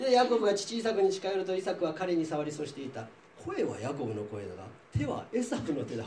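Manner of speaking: measured Bible reading voice with light character colour; scripture reading. Acted. Teacher reading Genesis to the class, measured reading voice. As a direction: read the passage out to the class.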